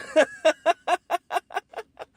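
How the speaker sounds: sudden high-pitched laugh; laughing at the story; high burst of laughter. Real, not acted. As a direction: break into a real laugh at the absurdity. Laughs at shoe swap story.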